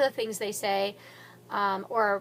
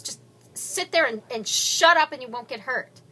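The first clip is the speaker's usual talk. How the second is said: threatening order; 3 semitones higher, 8 dB louder than usual. Acted. Gunman orders hostages.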